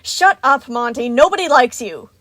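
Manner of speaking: loud, high angry defense; fierce shouted rebuke; ferocious snarl. A narrator's reading. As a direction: lash out to protect a friend. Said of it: "Roxy snarled with surprising ferocity".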